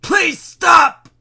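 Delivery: high, loud anguished cry; shout. A narrator's reading. Mayor screaming at the hiss in his ears, loud shout. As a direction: scream it out in pain. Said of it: "he shouted".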